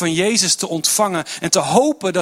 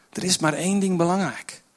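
ordinary talk, nothing marked

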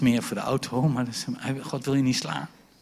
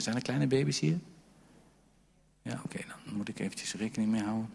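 ordinary talk, nothing marked

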